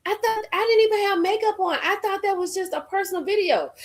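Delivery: high, mocking put-on whine; high mock voice quoting her excuse. Mocking quote of someone's excuse.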